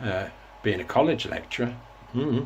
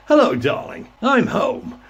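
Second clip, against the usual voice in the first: much higher, louder, overdone announcer voice; phony radio DJ voice; 10 semitones higher, 6 dB louder than usual. Retold or imitated. Mocking how radio DJs talk at home, hammed-up phony DJ voice. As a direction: ham it up like a cheesy local DJ coming home. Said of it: "They speak this sort of phony voice".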